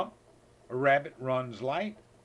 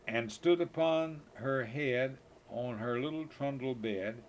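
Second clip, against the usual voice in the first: usual speaking voice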